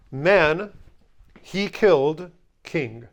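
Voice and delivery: very slow literal translation; slow word-by-word gloss. Shown as a demonstration. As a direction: drag each word out for the class. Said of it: "I'm just gonna say it this way".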